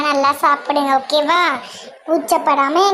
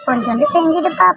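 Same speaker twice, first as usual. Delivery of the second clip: ordinary talk, nothing marked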